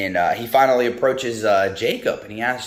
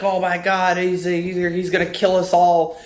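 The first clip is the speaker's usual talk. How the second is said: high-pitched mock panic; freaking out; 7 semitones higher than usual. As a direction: act out someone panicking, pitched up. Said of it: "he's just freaking out".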